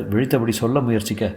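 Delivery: ordinary delivery, nothing marked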